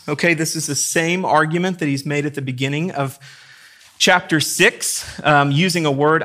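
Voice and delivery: normal talking voice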